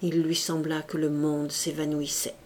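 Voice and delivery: usual speaking voice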